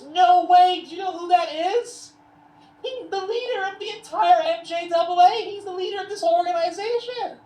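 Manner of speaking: high, loud imitation of an incredulous woman; shocked disbelief.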